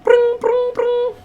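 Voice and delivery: very high trilled "brrring"; imitated phone ring. Caller phoning the Visa office, imitated ringing. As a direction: trill like an old telephone ringing.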